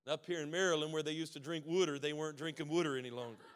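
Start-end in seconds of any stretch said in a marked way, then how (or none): 1.7-2.0 "water" said as "wooder"; Maryland pronunciation. Shown as a demonstration. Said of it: "And we don't say wooder anymore". 2.7-3.0 "water" said as "wooder"; Maryland pronunciation. Shown as a demonstration. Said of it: "And we don't say wooder anymore".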